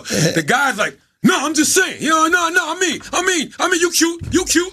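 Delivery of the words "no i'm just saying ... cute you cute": high-pitched, flustered backtracking; nervous backpedal. Retold or imitated. Retelling a scared stranger suddenly calling Wax cute. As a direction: scramble to take it back, voice up high.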